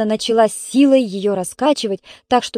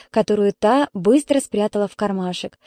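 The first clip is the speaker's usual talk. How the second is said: usual speaking voice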